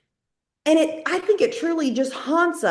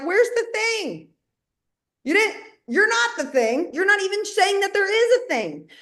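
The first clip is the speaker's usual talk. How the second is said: mock exasperation; 8 semitones higher than usual. Mimics people wondering, then answers them.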